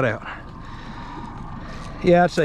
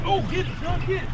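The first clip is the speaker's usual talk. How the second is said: high urging cheer; egging him on, pitched up; excited cheering; 12 semitones higher, 6 dB quieter, faster than usual. Real, not acted. Cheering on a catch.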